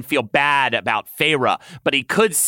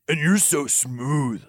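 raspy; 2 semitones higher, slower than usual. Acted. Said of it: "Lucien rasped".

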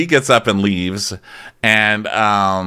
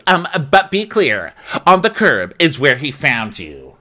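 slow, high-starting put-down; mock cutting retort; 4 semitones higher, slower than usual. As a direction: imitate a deliberate comeback, dropping in pitch. [name]'s acted cutting comeback.

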